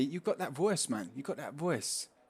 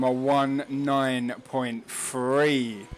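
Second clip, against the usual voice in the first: drawn out; much slower than usual. Real, not acted. Savouring the lap time.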